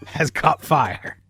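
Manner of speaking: normal talking voice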